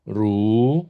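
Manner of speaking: RU drawn out, dipping then rising; long swung RU; long third tone. Shown as a demonstration. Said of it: "nada tiga lebih panjang".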